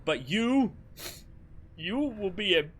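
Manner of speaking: high, loud, grandly earnest knight; wholesome knight voice. Acted. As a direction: proclaim it like a noble knight. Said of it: "somehow wholesome Spandam voice".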